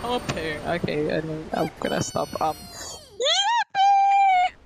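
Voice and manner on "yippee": very high, loud squeal; over-the-top cheer. Pretend. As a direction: burst out in a shrieked cheer. Sudden cheer, then apologises.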